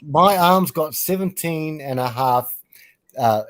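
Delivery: ordinary talk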